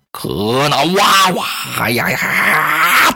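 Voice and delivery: slow, drawn-out bellowing roar; roaring war cry. Acted. Zhang Fei roaring at the woodcutter, a slow bellow. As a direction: let out a fierce general's roar.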